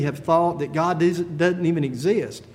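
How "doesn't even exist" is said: ordinary talk, nothing marked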